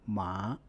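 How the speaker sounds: ma in Mandarin second tone, rising. Shown as a demonstration. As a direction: lift ma upward like a question. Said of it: "The second tone is a rising tone".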